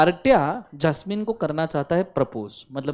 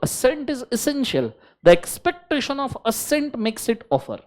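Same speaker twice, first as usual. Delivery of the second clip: slow dictation; 6 semitones higher, much slower than usual. Shown as a demonstration. Teacher dictating.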